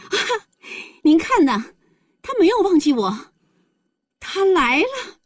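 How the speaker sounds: put-on high-pitched, elated girl; happy exclamation. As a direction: cry out overjoyed, pitch soaring. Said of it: "大声喊道". (幸福激动地高声喊)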